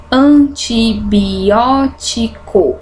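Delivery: very slow, syllables spaced out; slow dictation for counting syllables. Shown as a demonstration. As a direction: count out each syllable of "Antibiótico". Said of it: "seis sílabas".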